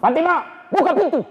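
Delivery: high, demanding call through the door. Acted. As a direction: bang on the door and call her. Umar calling at the door.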